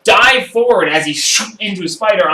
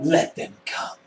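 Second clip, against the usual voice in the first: a hushed, high villain line; menacing villain; 11 semitones higher, 8 dB quieter than usual. Acted. Mage readying his staff, coolly daring them. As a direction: breathe it like a confident threat.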